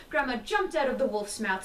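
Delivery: ordinary delivery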